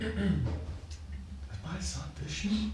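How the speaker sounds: soft, slow, high-pitched wondering; astonished mother. Retold or imitated. Yashoda after seeing the universe in his mouth, mock astonished. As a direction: imitate a mother's hushed amazement. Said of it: "And then she's just like".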